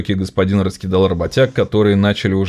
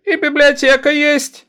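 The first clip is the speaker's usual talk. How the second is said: put-on high, slow boyish treble; young treble; 3 dB louder than usual. A narrator's reading. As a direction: pipe up like a lad. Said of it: "молодой дискант". (мальчишеский дискант)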